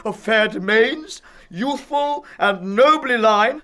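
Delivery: loud, high exasperated rant; maddened. Acted. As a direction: pound out the grievances in a fury. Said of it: "it makes me mad".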